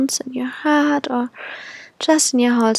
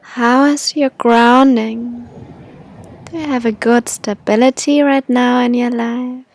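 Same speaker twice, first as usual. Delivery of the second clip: airy breathy mystical healer. Acted. Leads a guided meditation.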